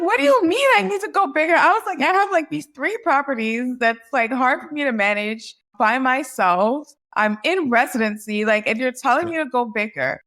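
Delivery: high, loud, fast retold protest; protesting the CPA's advice in disbelief; incredulous. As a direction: squeak out the disbelief and let it settle. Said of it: "And I'm like".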